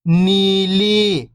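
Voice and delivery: whole word, level then falling at the end; syllables blended. Shown as a demonstration. Teacher showing beginners the joined word, syllables blended. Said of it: "तो मिलके बन जाएगा".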